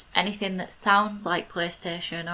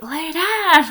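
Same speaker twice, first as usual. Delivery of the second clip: much higher, loud Yorkshire "Lay down"; shouting at the dog; 10 semitones higher, 7 dB louder than usual. Retold or imitated. Frustrated child yelling at her Nintendogs puppy, loud shout. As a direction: yell "Lay down" at a stubborn game dog. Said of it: "shouting at it".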